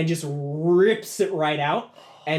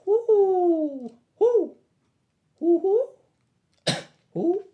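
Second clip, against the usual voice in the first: high, loud owl hooting; owl hoots; 6 dB louder, much slower than usual. Acted. Game master voicing the approaching owl, high loud hoots. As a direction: hoot like a mechanical owl in the dark. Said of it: "you hear this".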